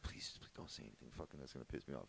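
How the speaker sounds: low, very soft pleading to himself; silent pleading. Retold or imitated. Nephew silently begging before uncle answers, hushed low plea. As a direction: say it under your breath, like a dreading thought. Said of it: "I was just like".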